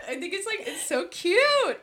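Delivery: high-pitched delighted cooing; gushing. Real, not acted. Gushing over the friend's accent.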